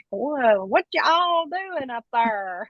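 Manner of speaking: exaggerated, drawn-out Southern drawl; mocked Southern accent. People ribbing Southerners about their speech, exaggerated Southern accent. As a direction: stretch it slowly, hamming up the Southern accent. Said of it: "a lot of flack for our accent".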